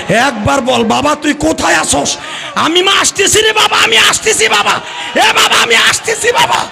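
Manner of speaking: very high frantic wail of a mother; shrieked, rising maternal panic; mother's screaming cry. Retold or imitated. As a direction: cry out desperately to a lost son. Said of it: "মা চিল্লানি দিয়ে কয়".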